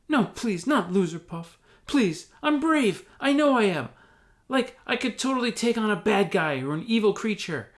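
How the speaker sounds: high, loud desperate plea; pleading. Acted. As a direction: beg frantically to stay out. Hobbit begs again.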